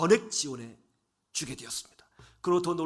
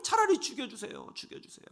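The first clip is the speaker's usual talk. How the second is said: fading from a cry to a whisper; broken plea trailing into breath; despairing plea; 7 semitones higher than usual. Retold or imitated. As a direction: beg to die, voice dying away.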